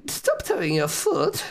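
very high, prim over-polite voice; mock the friend's meek request; mock politeness. Mocking timid politeness.

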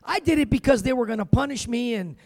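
ordinary talk

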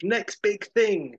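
slow, high and loud, like a headline screaming; headline announce. Retold or imitated. Reading the magazine cover in a big, slow headline voice. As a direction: stretch out each word like big print. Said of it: "screams the headline".

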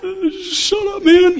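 squeaky falsetto shut up; high mock exclamation. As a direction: yelp it way up high like a startled kid. Mocking himself in falsetto.

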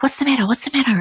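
high, anxious what's the matter; alarmed friend asking fast; worried. Retold or imitated. Fleur hearing her friend choke, high and worried. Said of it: "And she was like".

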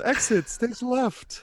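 slow, high theatrical announcement; theatrical exit. Pretend. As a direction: ham up a stage exit.

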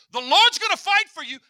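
loud, high preaching cry; preaching shout. Acted. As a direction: cry it out to the crowd. Preacher cries out Moses' words.

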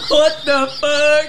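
loud, high, drawn-out disbelief; real shock, stretched out loud and high. Real, not acted. Listener reacting to the crude phone invitation, loudly shocked.

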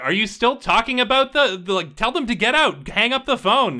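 high, loud impatient nag from the background; Savitri. Acted. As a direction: pitch it up and order him off the phone. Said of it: "Savitri's in the background is like".

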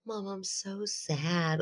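put-on overdramatic sad child. Mother imitating daughter's overdramatic sad plea, slow and soft. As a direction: drag it out like a sulky toddler. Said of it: "the most overdramatic".

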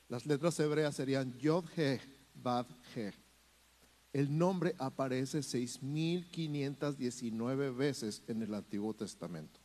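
ordinary delivery

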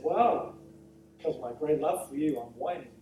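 low, subdued mock confession; retold answer, deflated and low; sheepish admission. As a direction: own up sheepishly, voice sinking away at the end.

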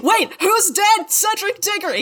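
high, loud frantic back-and-forth; hammed-up crowd chaos; panicked crowd. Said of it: "worst game of telephone ever".

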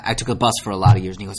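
ordinary talk, nothing marked

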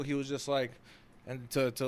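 ordinary talk, nothing marked

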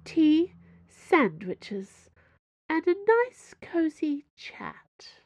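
high, slow, sweet Cardinal voice; a hostess with steel beneath; genteel Cardinal. A narrator's reading. Cardinal character voice.

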